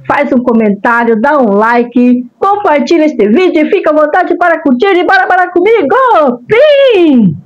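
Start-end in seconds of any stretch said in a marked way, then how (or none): 2.4-6.0 excited. Real, not acted. Excited call to viewers. 6.5-7.4 high bright "pim!" squeak; cute high squeak. Acted. Cutesy sound effect at intro.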